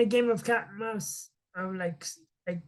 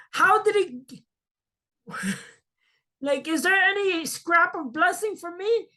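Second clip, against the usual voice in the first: outraged Esau; 7 semitones higher than usual. Retold or imitated. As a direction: play the cheated brother, half laughing. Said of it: "'Cause he's like".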